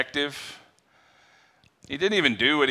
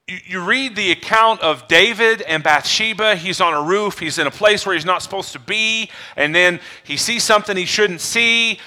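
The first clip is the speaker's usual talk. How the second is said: ordinary delivery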